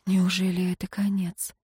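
soft breathy whisper; barely voiced worried question. A narrator's reading. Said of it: "прошептала я". (тихий шёпот)

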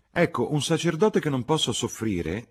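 high, loud, eager outburst; eager agreement. A narrator's reading. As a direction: jump in, keen to agree. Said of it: "desiderosa di esprimere in qualche maniera il suo consenso".

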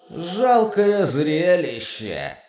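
slow, scornful booming demon; contemptuous demon thunder. Acted. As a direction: sneer down at him, slowly. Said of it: "загрохотал голос демона с нескрываемым презрением".

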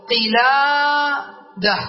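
قلادة with the long "laa" held, then "da"; drawn-out demonstration. Shown as a demonstration. Teacher asking students to focus on the word, drawn-out demonstration. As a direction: stretch the "laa" of قلادة very slowly. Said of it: "يعني ركز معي".

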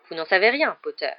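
usual speaking voice

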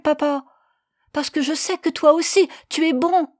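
put-on high, loud pleading little boy; child's voice. Boy begging his parents to spare the lamb, high and loud. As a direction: plead like a small boy defending his pet.

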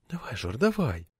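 soft urging hush; urging Zhora on under her breath, in a whisper. A narrator's reading. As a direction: nudge him on quietly. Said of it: "шептала мне Лето".

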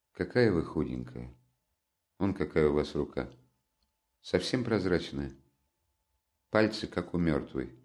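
tender pity. A narrator's reading. Pity for thin Sonya.

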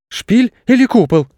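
put-on squeaky puzzled little kid; high child voice. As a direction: ask it like a confused child. Children's puzzled question in story. (высокий детский голосок)

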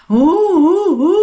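high, loud drawn-out hú wails; imitating a distant ghost crying hú hú; mournful ghostly wail. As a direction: wail like a grieving ghost in the night. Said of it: "tiếng khóc hụ hụ hụ".

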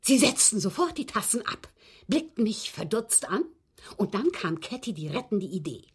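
ordinary talk, nothing marked

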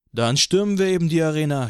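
rally the crowd; rowdy suggestion. A narrator's reading. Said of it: "schlug jemand vor".

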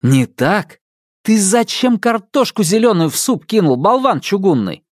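high, exasperated yell at the robot; angry scolding. Acted. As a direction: let him have it, you dolt. Brother yells at robot.

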